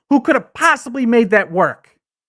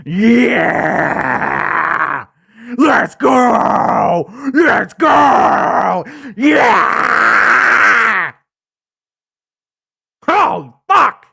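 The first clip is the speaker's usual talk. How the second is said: pumped-up yelling; much slower than usual. Acted. Amped-up guy hyping himself after a bump, yelling. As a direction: yell like a fighter before the bell.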